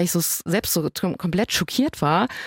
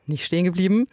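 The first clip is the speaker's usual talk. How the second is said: usual speaking voice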